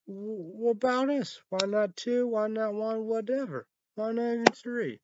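high, indignant mock protest from the team; voicing a snubbed team, asking why not them; whiny team complaint. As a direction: play the snubbed team whining about its ranking. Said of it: "They're like, eh".

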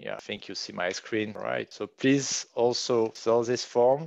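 normal talking voice

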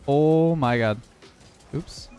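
usual speaking voice

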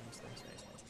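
hushed, breathless whisper; whispered nice. Real, not acted. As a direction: whisper it under your breath. Whispered praise mid-game.

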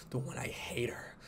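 breathy hateful whisper, no voice at all. Acted. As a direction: hiss the hatred under your breath. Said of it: "A whisper".

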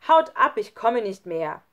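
loud, high burst, then firm; angry shout. A narrator's reading. Lukas sends the others away, angry shout. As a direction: drive them out of the room. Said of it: "rief Lukas. So laut".

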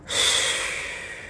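long, breathy exhale; heavy sigh. Real, not acted. Storyteller recalling the confusing birthday, long sigh. Pause in her own confusion.

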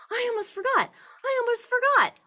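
high, loud excited shout. Acted. Farmer shouting to the animals as he runs. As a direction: shout it across the farm. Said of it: "the farmer shouted".

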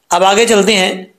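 ordinary talk, nothing marked

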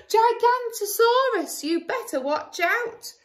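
high loud warning shout; loud high cry of fake danger; alarmed shout. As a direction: yell a warning to friends. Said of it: "they heard a shout".

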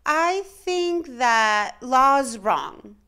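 put-on a child bravely pushing out each word; small second grade voice. A narrator's reading. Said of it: "her second grade voice didn't sound very strong".

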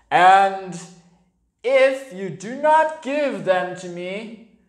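a big bear growling a slow threat; menacing bear. A narrator's reading. Bear threatening the boy Stephen, slow and menacing. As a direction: loom over the boy and threaten.

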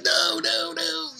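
mock panic, very high and squeaky; boy panicking as the girl threatens his hand; freaking out. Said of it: "He's freaking out".

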